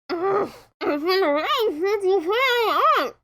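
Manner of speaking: slow, grumbling whine; fed up and groaning it out; complaining. Acted. Rainbow, tied up, complaining slowly to her friends. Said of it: "Rainbow complained".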